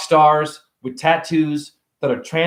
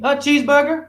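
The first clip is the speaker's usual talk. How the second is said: put-on higher little kid voice; 11 semitones higher than usual. A kid answering a stranger, higher small voice. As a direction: answer like a shy ten-year-old.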